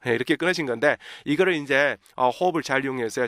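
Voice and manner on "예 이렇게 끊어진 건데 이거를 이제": ordinary talk, nothing marked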